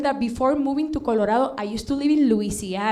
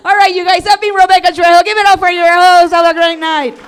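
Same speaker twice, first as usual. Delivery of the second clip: loud, higher hype-up announcer; emcee sign-off; 7 semitones higher, 12 dB louder than usual. Acted. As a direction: pump the room up as you leave. Closing her set as host.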